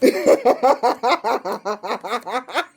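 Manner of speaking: long staccato cackle; real helpless laughing fit; uncontrollable laughter. Real, not acted. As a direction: laugh on and on until breath runs out. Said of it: "Why am I laughing so much?".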